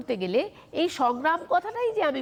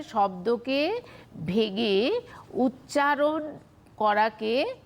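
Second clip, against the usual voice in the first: slow, word by word with pauses; slow dictation; much slower than usual. Shown as a demonstration. Teacher giving students a definition to write down, slow dictation. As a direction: dictate it in separated chunks, drawing out each word. Definition repeated slowly for note-taking.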